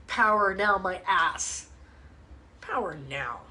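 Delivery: a scornful mutter sinking very low and soft; mocking sneer. Real, not acted. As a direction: sneer the title away, sinking down. Mocking the book title.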